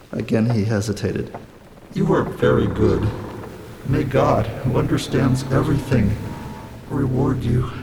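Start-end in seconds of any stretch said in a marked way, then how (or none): 1.9-7.7 solemn, halting stranger's voice; measured blessing, broken by pauses; grateful, wretched castaway. A narrator's reading. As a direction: bless them with earnest gratitude.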